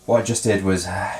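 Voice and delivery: winded, catching breath. Real, not acted. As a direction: talk while short of breath. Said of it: "well, I'm kind of out of breath".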